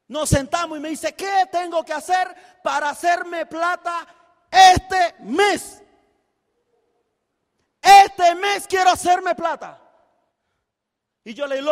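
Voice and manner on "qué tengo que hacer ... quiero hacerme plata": exigiendo a gritos, mucho más fuerte; ansia gritada. Retold or imitated. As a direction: reclama la plata con hambre. Ansia de ganar dinero. (al mentor, gritando con ansia por ganar plata)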